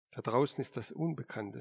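usual speaking voice

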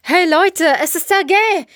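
high-pitched, elated calling out; euphoric shout. A narrator's reading. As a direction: shout the great news to the crew. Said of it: "rief Vitali euphorisch".

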